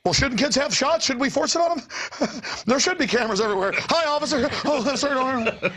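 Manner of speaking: high, fast mock servility; mock simpering citizen. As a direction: fawn over the officer with giggles. Mocking compliant citizens.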